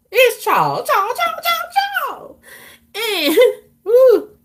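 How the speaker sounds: name wailed over and over way up high; mock whining wail. Complaining she can't talk nasty to Charles, mock wail. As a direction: whimper the name like a tantrum.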